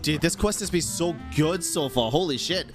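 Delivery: usual speaking voice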